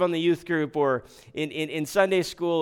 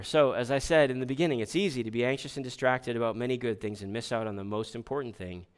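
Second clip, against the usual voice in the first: usual speaking voice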